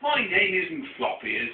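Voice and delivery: slow, loud protest; yell. Acted. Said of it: "yells Rabbit".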